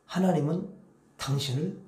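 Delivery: gentle quiet blessing; hushed tender words to the spouse; soft blessing. Shown as a demonstration. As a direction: say it softly, lovingly. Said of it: "그다음에".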